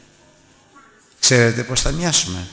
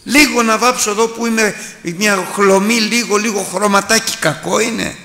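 higher, louder mock of a vain young woman; preacher mimicking a worldly girl, pitched up; 10 semitones higher, 4 dB louder than usual. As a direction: play the coy girl defending her makeup. Vain woman quoted mockingly.

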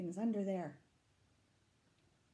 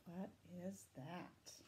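plain reading voice

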